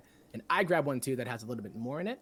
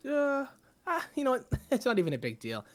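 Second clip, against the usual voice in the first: high bard voice; 8 semitones higher, 3 dB louder than usual. Acted. Bard speaking to mayor.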